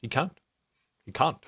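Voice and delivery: put-on echoing the AI's accent back, lightly; a hint of his accent on "He can't", said twice; copying his accent. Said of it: "I emulated his accent".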